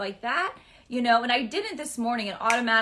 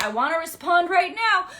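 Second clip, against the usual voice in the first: impatient urge; 7 semitones higher, 7 dB louder than usual. Retold or imitated. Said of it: "so you're like".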